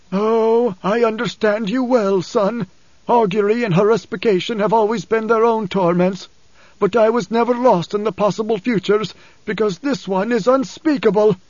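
wounded, husky quaver; husky and trembling. A narrator's reading. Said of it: "his voice husky and trembling".

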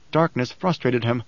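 plain reading voice

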